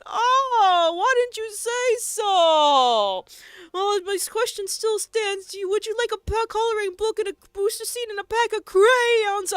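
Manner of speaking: put-on high, loud, sing-song baby talk; mock condescending voice. Streamer teasing a goblin player, high mock condescension. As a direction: talk down to him like a toddler.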